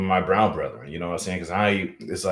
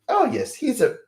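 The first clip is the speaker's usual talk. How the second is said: much higher, prissy upper-class British voice; mock posh British accent. Mocking how Americans hear a British accent, high posh British voice. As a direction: play a pompous English toff. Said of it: "using your Brit accent".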